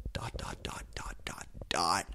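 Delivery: whispered, hushed build-up; suspense whisper. A narrator's reading. Narrator building suspense before the name, whispered. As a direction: tease the reveal softly.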